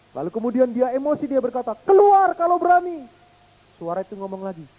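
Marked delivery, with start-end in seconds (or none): 1.9-3.1 put-on high, loud child's dare; angry challenge. Angry little boy challenging the hills, loud high dare. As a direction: dare the voice to show itself.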